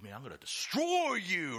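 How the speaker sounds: hammed-up press conference trash talk; trash-talking yell. One fighter threatens the other at a press conference, mock yell. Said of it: "It's like yelling at each other".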